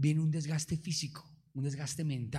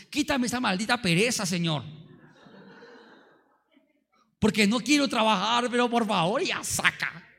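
loud, hammed-up whiny prayer, higher; mock lazy prayer; 5 semitones higher, 5 dB louder than usual. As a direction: beg God loudly to take the laziness away. Parodia de oración perezosa. (oración perezosa burlona, en voz alta y aguda)

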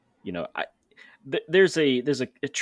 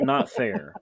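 squeaky protest; 14 semitones higher than usual. Real, not acted. Joking self-correction.

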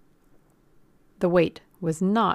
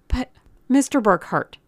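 high, loud protest; urgent plea; 7 semitones higher, 7 dB louder than usual. A narrator's reading. Girl protesting to the gunman, urgently pleading. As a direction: plead as if about to be cut off.

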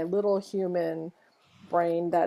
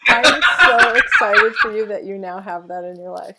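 loud, gushing through laughter; big laughing cheer for a friend; laughing excitement; 2 semitones higher, 10 dB louder than usual. Real, not acted. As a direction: burst out congratulating between laughs. Reacts laughing to friend's joke.

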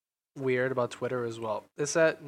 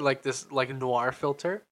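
usual speaking voice

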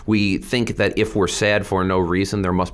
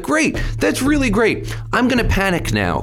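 much higher, sarcastic 'great' tipping into panic; mock panic. Reacting to the editors publishing his op-ed, mock-panicked. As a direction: sell the fake cheer before the dread lands. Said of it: "It's like".

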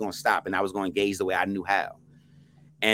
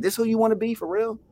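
imitating the woman, much higher; a pointed challenge in her voice; woman's challenge; 13 semitones higher than usual. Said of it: "And she's like".

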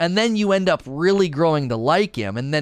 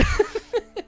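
high-pitched amused laugh; high burst of laughter; 3 dB quieter than usual. Real, not acted. Laughing at son's insult.

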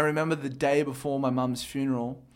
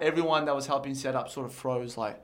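ordinary talk, nothing marked

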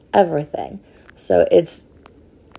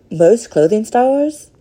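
higher, accidentally odd lilt; oddly said; 5 semitones higher, 3 dB louder than usual. Real, not acted. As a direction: let the phrase pop up higher by accident. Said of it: "why I said that that way".

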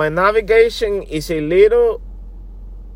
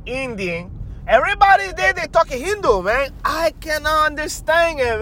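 lightly higher, put-on accented sidekick voice; Jinx voice; 7 semitones higher than usual. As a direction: chatter on as the goofy character. Jinx explains his job.

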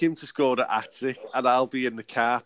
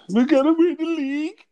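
put-on fan voice; 6 dB louder than usual. Mock football fan boast.

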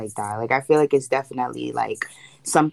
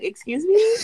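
mock ghost lady. Jokes as ghost lady arriving.

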